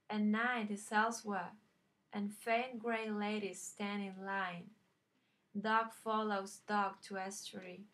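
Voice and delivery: steady, deliberate poem recital; poetry reading. Acted. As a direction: read it as verse, line by line with pauses. Reads a poem aloud.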